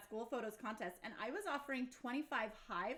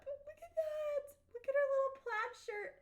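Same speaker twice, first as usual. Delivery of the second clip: gushing; 12 semitones higher than usual. Real, not acted. Gushing over a childhood photo.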